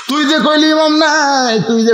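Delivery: high, chanted accusation sliding downward; crowd's accusation. Acted. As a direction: challenge him in a drawn-out preacher's cry. Worshippers angrily confront the madman. (সুরে টেনে অভিযোগ)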